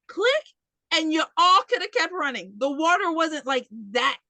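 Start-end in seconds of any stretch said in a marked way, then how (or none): 0.1-0.5 mock click. Imitated key sound.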